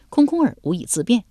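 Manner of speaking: plain reading voice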